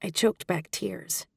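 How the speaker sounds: ordinary delivery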